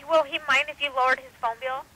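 ordinary talk

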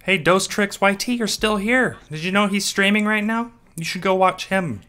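mock friendly bye-bye; sarcastic cheery goodbye. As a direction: sweetly kick him out of the stream. Mock-cheery send-off to a viewer.

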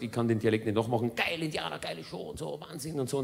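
a hint of an overexcited filmmaker; mumbled fan raving, barely voiced; lightly voiced East German director. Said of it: "ostdeutsche Dokumentarregisseur".

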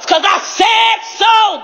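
high, drawn-out declaration; yelling. Real, not acted. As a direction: yell it slowly, every word stretched. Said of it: "I, I gotta".

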